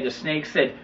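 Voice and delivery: normal talking voice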